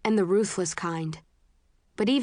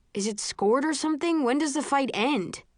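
higher, unsure recruit voice; nervous young man; 7 semitones higher than usual. Acted. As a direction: ask anxiously, hoping it ends. Al nervously asks Eric.

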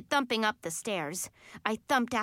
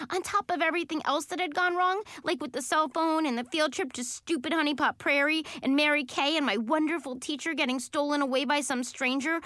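ordinary delivery

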